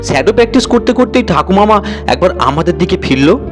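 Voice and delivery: usual speaking voice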